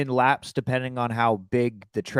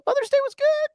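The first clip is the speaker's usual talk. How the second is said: Mother's Day pitched way up high; high squeaky take. Acted. Answers the Mother's Day question twice.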